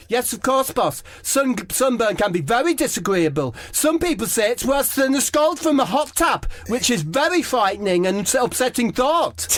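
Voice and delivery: very high, earnest, fussing voice; teammate impression. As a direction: fuss over the danger earnestly. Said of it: "Debbie says".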